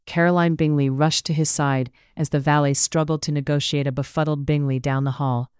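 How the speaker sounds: ordinary delivery, nothing marked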